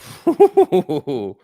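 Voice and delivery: loud burst of real laughter. Real, not acted. Trader watching a giant Apple move, loud laughing burst. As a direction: let the laugh burst out at the chart.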